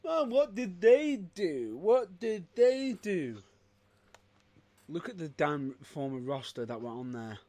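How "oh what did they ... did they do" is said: slow, loud mock whine of the critics. Mocking what critics ask, in a slow loud whine. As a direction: drag out the complaint, louder. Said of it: "A lot of people turn around and say".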